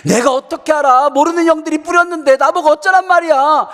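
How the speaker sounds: put-on high, loud child's protest; acted-out outburst of mock innocence; indignant insisting. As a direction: argue back like a wronged kid. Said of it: "막 그러면서 막 주장하대요".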